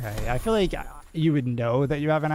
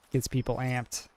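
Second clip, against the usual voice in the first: usual speaking voice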